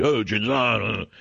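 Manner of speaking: drawn-out mock mobster toast; hammed-up mafia-film Italian; mafia movie character. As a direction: stretch it slowly like a movie mafioso. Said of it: "You've seen it in every mafia movie".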